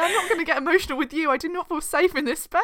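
high, loud pretend indignation; hammed-up wounded complaint; mock offence. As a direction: protest as if hurt, playing it up. Mock-offended imitation of friend's complaint.

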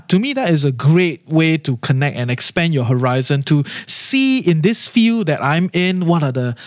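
ordinary talk, nothing marked

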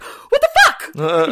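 high, loud, slow shocked outburst; mock shock. Macbeth spotting the ghost again mid-toast, loud mock shock. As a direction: gasp it out at the ghost. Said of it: "he's like".

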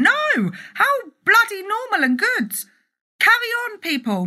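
rally the audience with passion; passionate rally. Real, not acted. Vlogger to fellow entrepreneurs, fervent rallying cry.

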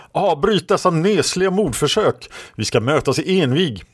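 high-pitched indignant order; roared command. A narrator's reading. Red Lady halts the attack on her opponent, high roared command. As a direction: order them to stop, pitch well up. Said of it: "röt Röda Damen".

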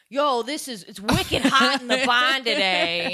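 put-on loud, slow, high Boston accent; hammy Boston accent, drawn out. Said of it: "they gave her a fucking Boston accent".

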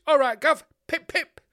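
high, loud cheeky urchin; cockney chimney sweep. Pretend. [name]'s kids as chimney sweeps, high cockney chirp. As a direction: chirp it like a Victorian street kid. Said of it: "dressed like fucking chimney sweeps".

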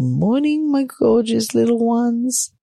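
high, drawn-out sing-song greeting; imitating a doting parent's baby talk; cooing to babies. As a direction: greet tiny babies adoringly. Partner greeting the babies, retold.

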